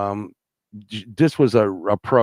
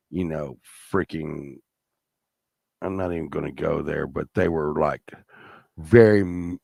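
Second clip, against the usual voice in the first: ordinary delivery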